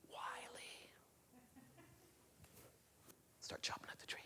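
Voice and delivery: hushed suspense whisper. A narrator's reading. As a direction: whisper it like a secret.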